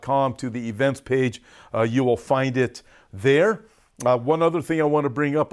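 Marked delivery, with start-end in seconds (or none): none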